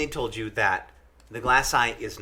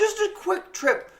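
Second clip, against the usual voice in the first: much higher, slow, sing-song sarcasm; mock chipper. Friend mocking the quick trip, high sarcastic sing-song. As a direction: chirp it like a cheery ad.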